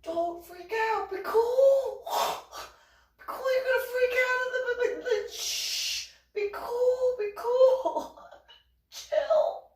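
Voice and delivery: very high, strained, squealed plea; acted panic, desperately trying to calm someone; frantic hushing. Acts out frantic hushing of onlookers.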